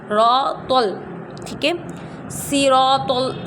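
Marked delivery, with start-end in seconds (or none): 2.3-3.5 صراط with a thick swad, slow; heavy swad. Shown as a demonstration. Teacher contrasting swad with a thin s sound. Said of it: "سواد میں سی کی آواز زیادہ نہیں آتی".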